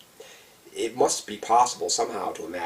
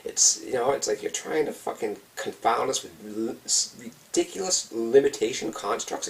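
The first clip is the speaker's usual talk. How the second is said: usual speaking voice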